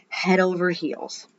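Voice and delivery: plain reading voice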